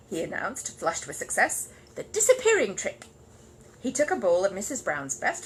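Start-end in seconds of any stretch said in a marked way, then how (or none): 2.0-3.1 high, loud announcement; grand announcement. Acted. Paddington flushed with success announcing, proudly. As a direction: announce the big trick proudly.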